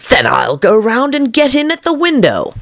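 high fussy rabbit voice to itself; rabbit muttering. A narrator's reading. The Rabbit planning aloud, high and fussy. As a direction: plot your way round, fussing. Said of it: "Alice heard it say to itself".

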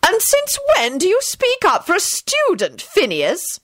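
high, sharp indignant demand. A narrator's reading. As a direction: challenge the interrupter sharply. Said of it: "she demanded sharply".

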